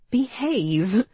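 high, amused mock scolding; grinning warning. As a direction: scold him while grinning. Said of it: "Georgie cautioned, but she was grinning".

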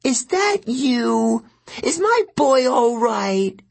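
a fragile mother calling out, slow and anxious. A narrator's reading. May calling to her son from the kitchen, high and slow. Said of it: "May Castellan called".

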